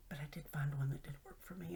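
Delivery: unvoiced quick whisper. Real, not acted. Sharing her discovery with viewers, whispered. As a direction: confide it in a hushed rush. Confiding aside.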